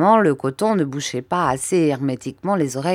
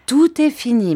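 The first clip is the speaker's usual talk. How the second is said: eager announcement; 8 semitones higher, 6 dB louder than usual. Acted. Doctor announces the birth.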